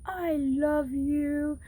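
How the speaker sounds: high, slow, sweet little duck voice; a tiny high cartoon duck saying thanks, drawn out. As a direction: coo it slowly like a grateful duck. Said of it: "and the duck is like".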